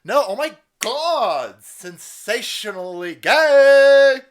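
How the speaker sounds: high, loud, slow hammed-up squeal; over-the-top mock excitement; mock excited announcer. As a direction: build to a huge mocking reveal. Mock hype reveal.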